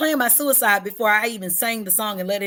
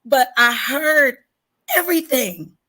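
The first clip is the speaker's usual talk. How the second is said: high, slow, voice close to breaking; holding back tears; 5 semitones higher, 7 dB louder than usual. Real, not acted. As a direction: fight the tears on every word. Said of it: "I'm trying not to cry".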